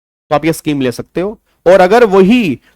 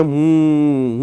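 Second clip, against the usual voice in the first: drawn-out hmm; much slower than usual. Shown as a demonstration. Teacher demonstrating the bored reply hmm. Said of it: "हम्म करके बात चलती है".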